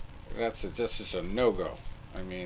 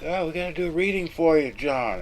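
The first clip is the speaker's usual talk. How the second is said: mock psychic; 8 semitones higher, 6 dB louder than usual. Pretending to be psychic at guest.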